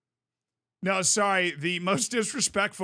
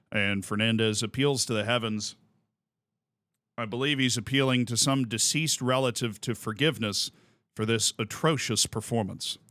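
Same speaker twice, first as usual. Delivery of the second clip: low, dry, measured announcer; Bob Costas voice; 7 semitones lower than usual. Retold or imitated. Retelling Costas mocking a pitcher, low solemn announcer. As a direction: deliver the insult with smooth gravity. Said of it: "he's like".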